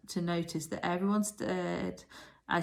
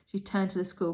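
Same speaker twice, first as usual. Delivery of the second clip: ordinary delivery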